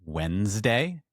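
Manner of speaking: "Wednesday" as WENZ-day, silent first d, rising end. Shown as a demonstration. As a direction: say "Wednesday" in two syllables, questioning lift. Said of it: "when I say the word".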